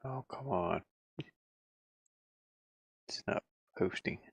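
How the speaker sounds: normal talking voice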